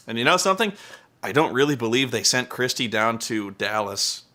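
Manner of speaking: ordinary delivery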